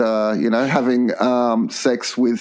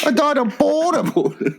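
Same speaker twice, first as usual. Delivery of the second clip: very high, drawn-out mock wife; high, slow comic put-down; old wife's voice; 13 semitones higher, slower than usual. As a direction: play a scornful dead wife, pitched way up. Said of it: "What would my old wife say".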